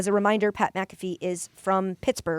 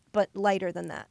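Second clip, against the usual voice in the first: ordinary delivery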